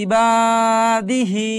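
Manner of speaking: ইবাদিহি with বা and হি held long; stretched recitation. Shown as a demonstration. Teacher demonstrating madd to students, drawn-out. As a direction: draw out বা and হি in ইবাদিহি. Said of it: "এভাবে টানতে হবে।".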